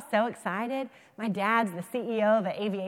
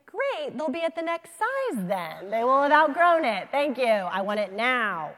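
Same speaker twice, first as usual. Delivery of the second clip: mock exasperation; 3 semitones higher, 7 dB louder than usual. Mock exasperated consumer reaction.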